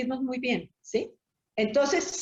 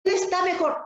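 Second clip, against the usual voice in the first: higher, hammed-up soothing of a mourner; mock consoling; 5 semitones higher than usual. Mourner mimicking what people tell the bereaved, higher and sing-song. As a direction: imitate the clichéd comforter, pitched up. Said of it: "decimos algo".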